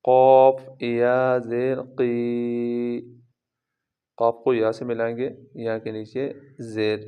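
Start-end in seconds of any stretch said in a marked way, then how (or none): 0.0-3.2 قاف with a light, thin sound, very slow; slow careful قی; thin qaf. Shown as a demonstration. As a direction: soften the قاف before zer. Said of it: "قاف ویسے تو بھاری آواز سے پڑھتے ہیں".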